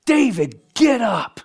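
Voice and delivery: high loud calling shout; rapture wake-up shout. Retold or imitated. Jesus shouting David awake at the rapture. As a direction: call him up from sleep.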